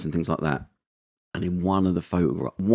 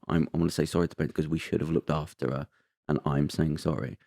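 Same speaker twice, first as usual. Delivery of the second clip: softer, voice thick with real emotion; tearing up; 4 semitones lower, 7 dB quieter than usual. Real, not acted. Moved while retelling the prime minister's apology, tearing up. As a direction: hold back tears as you repeat it. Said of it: "I'm gonna get tearing up saying it now".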